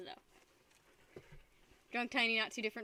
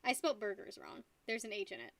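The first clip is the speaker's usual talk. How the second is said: ordinary talk, nothing marked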